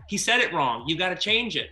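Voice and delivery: a hint of bossy studio notes; quoting the producers. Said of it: "the producers were like".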